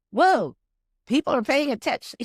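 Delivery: loud, high burst of amazement; astonished reaction. Retold or imitated. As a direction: exclaim it in wide-eyed surprise. Retold astonishment at audience response.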